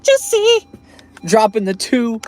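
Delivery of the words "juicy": a full scream of Juicy out the window; shouted Juicy. Pretend. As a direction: yell Juicy as loud and high as you can. Celebrating a tip.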